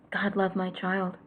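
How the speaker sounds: ordinary talk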